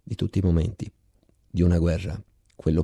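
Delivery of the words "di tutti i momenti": ordinary delivery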